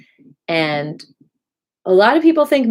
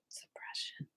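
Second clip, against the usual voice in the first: whispered release; 26 dB quieter than usual. Real, not acted. Teacher releasing suppression, whispered. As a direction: whisper on an outgoing breath. Breathed release at end of affirmation.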